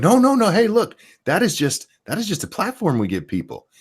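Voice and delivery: high-pitched, pleading objection; mock protest. Host retells the sued newspaper's defense, mock protest. Retold company protesting in court.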